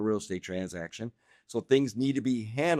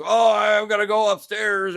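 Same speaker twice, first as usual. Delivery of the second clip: imitating grandpa; 8 semitones higher, 5 dB louder than usual. Grandpa voiced in a story.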